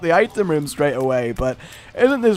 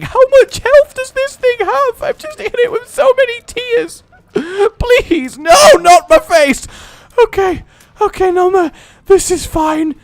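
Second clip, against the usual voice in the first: much higher, frantic pleas; panicked high pleading; 14 semitones higher, 6 dB louder than usual. Real, not acted. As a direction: beg the boss in high panic. Boss fight, panicking.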